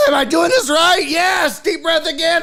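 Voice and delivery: high, loud, half-crying repeat; mock frantic cry. Patient panicking through the doctor's breathing instructions.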